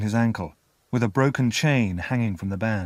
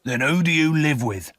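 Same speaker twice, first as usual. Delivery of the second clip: coarse questioning voice, a little raised; rough convict; 6 semitones higher, 4 dB louder than usual. A narrator's reading. Convict asks the boy about home, rough.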